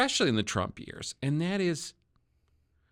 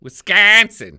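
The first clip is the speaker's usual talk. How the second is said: loud, higher Wisconsin twang in mock pride; exaggerated Wisconsin accent; 4 semitones higher, 12 dB louder, slower than usual. Host answering a guest's jab at his accent, exaggerated Wisconsin accent. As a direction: shout it in a hammed-up Wisconsin accent. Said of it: "with your Wisconsin accent".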